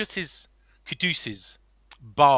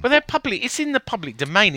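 defensive protest; 8 semitones higher, 6 dB louder than usual. Real, not acted. Defending reposting the videos.

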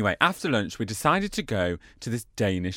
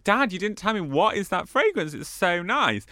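high, innocent teasing question; teasing dad with faux-innocent praise; mock sweet curiosity; 7 semitones higher than usual. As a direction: fake innocence to stir things. Daughter-in-law teasing father.

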